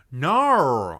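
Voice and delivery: loud drawn-out Australian "no"; mad, mock-Australian no. Streamer mad at friend's rating, loud Australian-accented no. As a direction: bend it into an angry Australian howl. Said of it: "I'm so mad I'm turning Australian".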